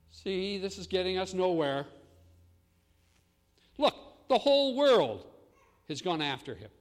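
frustrated officials complaining; exasperated Pharisees. Retold or imitated. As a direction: Pharisees grumbling to one another, exasperated and loud. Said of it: "were saying".

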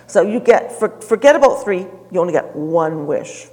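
loud, exasperated genie voice. Acted. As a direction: boom it out like a fed-up genie. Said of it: "The genie said".

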